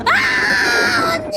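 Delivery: high panicked cry; terrified scream. Real, not acted. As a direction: scream in real fright. Scared scream at game.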